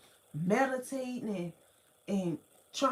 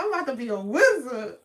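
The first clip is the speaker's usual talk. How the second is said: giddy excitement; 6 dB louder, faster than usual. Retold or imitated. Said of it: "I'm like".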